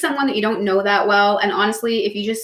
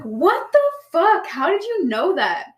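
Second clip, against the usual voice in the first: stunned disbelief; 8 semitones higher, slower than usual. Retold or imitated. Retelling her shock at partner.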